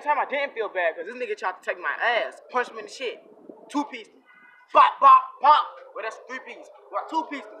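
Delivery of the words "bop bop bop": loud, punchy imitated blows; punch sound effects. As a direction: fire off each noise like a jab.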